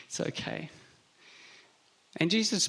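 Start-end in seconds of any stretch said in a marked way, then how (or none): 0.1-0.7 soft unvoiced whisper, trailing off; whispered reassurance. Thomas whispering to Jesus that he need not see scars. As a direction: breathe it gently, almost to himself. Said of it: "And Thomas is like".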